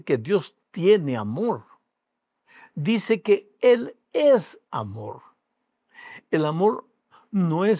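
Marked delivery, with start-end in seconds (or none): 2.7-5.2 slow, higher, lingering on "es"; stress on ES. Acted. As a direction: lean hard on "es" in "Él es amor". Contrasts tiene amor with es amor.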